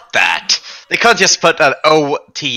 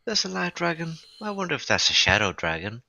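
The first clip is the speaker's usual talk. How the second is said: musing aside to group; 6 semitones higher, 7 dB quieter than usual. Acted. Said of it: "ask out loud to the group".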